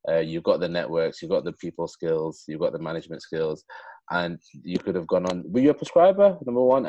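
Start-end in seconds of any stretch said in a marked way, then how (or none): 5.4-6.9 higher, louder pointed question; eager question. Real, not acted. Asking the guest about prescribing, eager and loud. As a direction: fire the question at him keenly.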